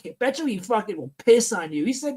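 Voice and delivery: normal talking voice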